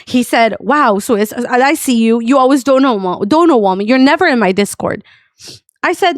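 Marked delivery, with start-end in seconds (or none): none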